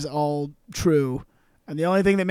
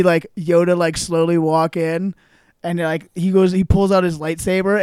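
ordinary talk

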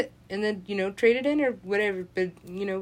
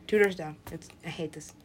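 ordinary talk

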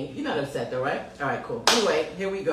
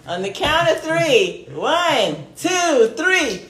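louder, higher build to three; excited countdown; 8 semitones higher, 9 dB louder, much slower than usual. Real, not acted. Host counts down to the surprise for her guest, excited and loud.

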